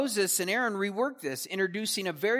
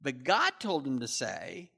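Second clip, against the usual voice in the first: ordinary talk, nothing marked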